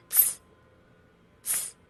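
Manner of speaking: voiceless ts as at the end of it's; pinyin c sound. Shown as a demonstration. Teacher showing learners pinyin c, a bare voiceless ts. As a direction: release ts alone with no vowel. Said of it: "sounds like T S as in it's".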